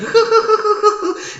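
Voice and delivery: high manic cackle; rapid, high mad laughter; Joker's cackle. Acted. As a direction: burst into high villainous giggles. Joker laughs at own line.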